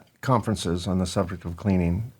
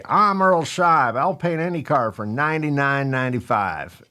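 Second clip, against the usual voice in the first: Earl Scheib; 4 semitones higher than usual. Retold or imitated. Host mimicking car-paint owner's commercials, distinct pitchman voice. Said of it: "in his distinct voice, he would say".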